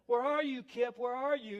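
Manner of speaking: parent playing seek. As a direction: play at hunting for a hidden child. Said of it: "you'd go like".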